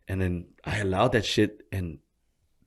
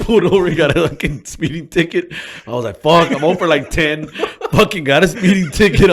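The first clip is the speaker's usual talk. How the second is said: high and loud, laughing through; laughing at his own bad luck; cracking up while telling it; 10 semitones higher, 13 dB louder than usual. Real, not acted.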